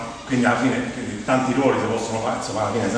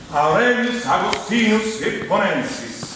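slow, higher-pitched declamation; mock-solemn, drawn-out title reading; grand Latin announcement; 6 semitones higher, much slower than usual. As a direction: proclaim the Latin name word by word. Grandly announces Augustine's Latin name.

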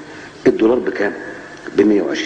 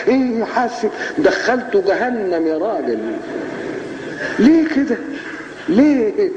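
laughing; 9 semitones higher, slower than usual. Real, not acted. Mocking the prosecutor's excess.

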